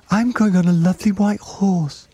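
put-on very high-pitched, eager child; excited child's cry. A narrator's reading. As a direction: pipe it up high, thrilled. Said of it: "cried another".

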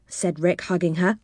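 plain reading voice

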